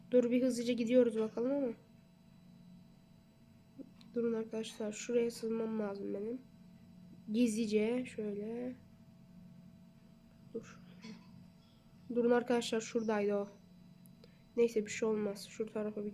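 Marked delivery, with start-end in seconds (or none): 4.1-10.8 soft, careful game whisper; quiet sneaking. Real, not acted.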